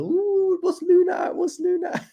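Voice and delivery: very high squeaky mum impression; mock-excited falsetto squeal. Streamer mimics mum asking about his charts, shrill excited falsetto. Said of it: "She's like".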